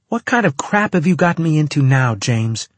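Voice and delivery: freaked-out woman confronting her partner; angry demand. A narrator's reading. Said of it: "she demanded".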